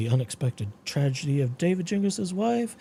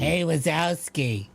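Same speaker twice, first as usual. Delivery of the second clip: raspy Roz voice; slower than usual. Retold or imitated. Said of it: "You could do a Hoboken Jan".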